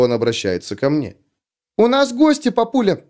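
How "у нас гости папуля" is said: put-on high squeaky four-year-old girl; squeaky little girl voice. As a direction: pipe it up like a tiny girl. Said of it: "писклявым голоском четырёхлетней девочки".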